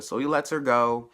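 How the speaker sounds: normal talking voice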